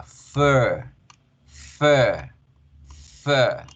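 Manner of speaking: "Fur" as a drawn-out FEEH with loose R; long E. Shown as a demonstration. Teacher drilling the word, "Fur" with long vowel. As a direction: hold the central vowel of "Fur", no trill. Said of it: "se pronuncia con la E larga".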